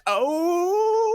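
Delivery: a mock startled gasp of a word; taken aback. Host reacting to the worker's reply, mock surprise. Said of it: "And I'm like".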